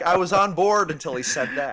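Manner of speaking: loud, high mock-betrayed player; mock player reaction. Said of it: "They're like".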